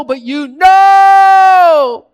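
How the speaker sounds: know drawn out in a long high shout; emphatic shout. Acted. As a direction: hit "know" hard, high and slow. Contrasting kinds of knowing.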